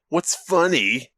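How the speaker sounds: ordinary talk, nothing marked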